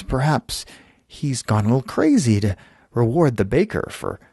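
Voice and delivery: usual speaking voice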